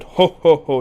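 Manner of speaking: loud, spelt-out "ho ho ho"; mock laugh. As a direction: imitate a hearty Englishman's laugh. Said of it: "The Englishman laughed and said".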